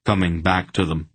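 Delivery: plain reading voice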